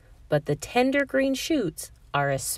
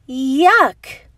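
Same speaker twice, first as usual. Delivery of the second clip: disgusted yuck; 7 semitones higher, 9 dB louder than usual. Acted. Cockroach smells mint.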